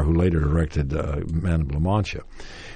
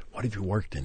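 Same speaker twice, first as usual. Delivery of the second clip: hushed, much softer aside; retold whisper; 2 semitones higher, 9 dB quieter, faster than usual. Man on the phone privately asking the young actor, retold whisper. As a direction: drop it right down, like a discreet aside. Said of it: "he whispered to me".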